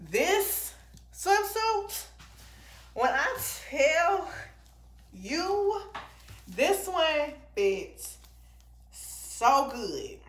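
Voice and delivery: high, slow, drawn-out gushing. Real, not acted. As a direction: stretch every word out in delight. Gushing over favorite swimsuit.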